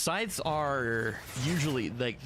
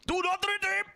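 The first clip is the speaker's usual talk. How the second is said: even higher, strained falsetto yelp; over-the-top mock warning; meme voice again; 3 dB louder than usual. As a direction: push the squeal further. Repeats meme line.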